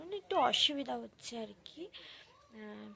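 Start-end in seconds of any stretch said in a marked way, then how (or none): none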